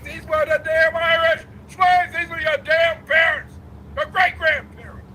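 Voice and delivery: fiery shout. Real, not acted. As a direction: shout it at the audience like an outraged preacher. Lecturer angry about Irish slave ancestry.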